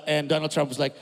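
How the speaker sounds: ordinary talk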